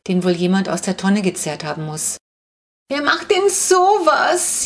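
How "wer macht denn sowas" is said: put-on high, slow wailing old lady; slow, high lament of a fussy old woman; old woman whining. A narrator's reading. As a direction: moan it like a shaken granny. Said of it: "jammert die alte Frau".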